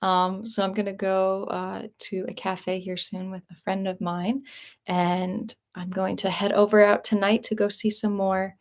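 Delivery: ordinary talk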